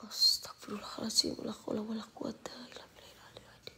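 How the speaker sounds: soft, hushed istighfar; whispered prayer. Real, not acted. Streamer praying after answering hecklers, whispered.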